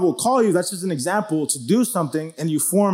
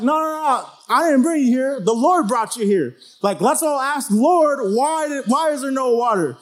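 much higher, pleading protest; imitating a defensive Moses; 8 semitones higher than usual. Moses pushing the blame back onto the Lord, much higher defensive protest. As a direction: wave off the blame, hands up.

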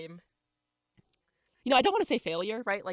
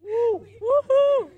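loud, high whooping cheer; excited cheer; 13 dB louder, much slower than usual. Real, not acted. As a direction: whoop it up with joy. Cheering the pacer bloopers.

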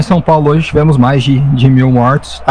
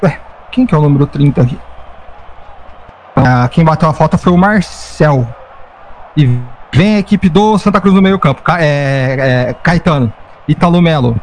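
live play-by-play; 3 semitones higher than usual. Acted. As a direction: follow the ball as it moves.

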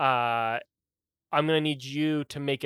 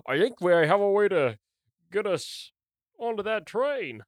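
lightly voiced old man, pitched up; a hint of an old man, halting; 5 semitones higher than usual. Old man pitches his idea to the party, lightly put-on higher voice.

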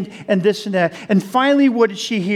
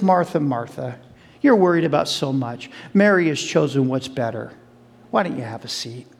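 gentle Jesus; 7 semitones lower than usual. Acted. Jesus calming busy Martha, low and gentle. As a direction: soothe her, settle her down.

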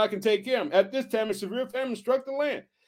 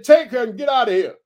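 loud quoted command; acted, forceful order to leave; shouted dismissal; 3 semitones higher, 7 dB louder than usual. A narrator's reading. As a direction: punch out the king's order. Repeats Pharaoh's dismissal as quote.

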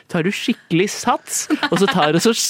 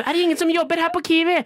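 indignant; 7 semitones higher, faster than usual. Pretend. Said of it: "så sier du".

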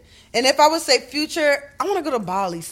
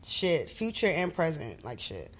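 thinking aloud; 6 semitones lower, 11 dB quieter, slower than usual. Real, not acted. Trailing off while thinking.

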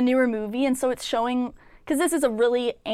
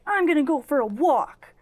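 higher-pitched, put-on defiant announcement; mock rant; 5 semitones higher than usual. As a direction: act out someone ranting to himself. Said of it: "little rant".

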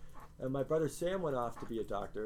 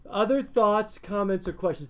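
calling out to class; 6 semitones higher, 9 dB louder than usual. Real, not acted. Calls out to the class.

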